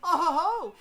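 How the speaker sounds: loud, high villainous "oh ho ho"; big theatrical cackle in character; vicious laugh. A narrator's reading. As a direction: belt out a gloating, wicked laugh. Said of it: "He laughs viciously".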